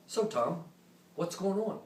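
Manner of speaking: lightly voiced laid-back commodore; breezy, like asking a friend at a party; casual buddy tone. Commodore casually asking the narrator for an update, lightly voiced. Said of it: "His tone of voice was if, you know, a buddy".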